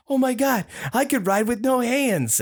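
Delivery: high, amazed squeal of discovery; thrilled kid realizing he can ride no-handed; excited gasp. Retold or imitated. Boy on bike, amazed at himself, high excited. Excited retold self-quote.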